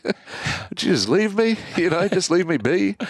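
high, slow, laughing plea to be left alone; half-laughing, begging to be left be; exasperated plea. Retold or imitated. Dad wishing his son would leave, exasperated high plea.

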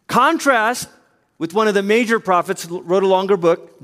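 ordinary talk

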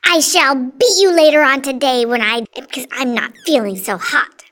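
loud, fast scolding; angry threat. A narrator's reading. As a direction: threaten the child in a rush. Cruel aunt threatens the boy.

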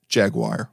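jaguar said as JAG-wire; mock-English "jaguar", as jag-wire; supposed English pronunciation. Shown as a demonstration. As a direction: end "jaguar" like the word wire. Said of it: "This is how the English say the word".

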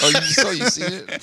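laughing out the question; amused, chuckling through words. Real, not acted. Laughing at the joke.

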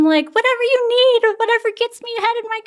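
mock people-pleaser gushing, high then easing down; gung-ho. Employee promising the boss anything to get ahead, gung-ho. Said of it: "gung-ho".

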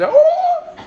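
very high, loud trilled joyful ululation; shrill-pitched festive ululation demo; ululation cry. Shown as a demonstration. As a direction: let out a celebratory ululating whoop, laughing. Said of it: "I don't know if anybody here can do this".

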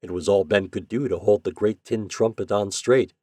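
usual speaking voice

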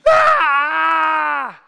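a huge high scream of terror; full-throated scream. Retold or imitated. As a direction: let out a wild, loud terrified scream. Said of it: "I'm screaming".

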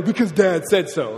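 a hint of a stern father; authoritative dad. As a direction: lay down the law like a dad. Preacher voices dad shutting down question.

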